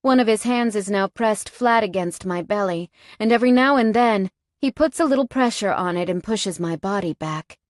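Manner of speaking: usual speaking voice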